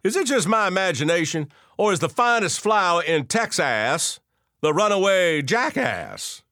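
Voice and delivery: hammed-up Texas twang; broad Texas twang. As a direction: lay on a thick Texas accent to tease the crowd. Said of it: "said Leonard in a broad Texas twang".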